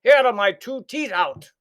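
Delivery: high, slow indignant complaint; outraged giant. A narrator's reading. Giant after breaking teeth, slow outraged. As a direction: rage about the bread. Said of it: "shouted Cuchulainn".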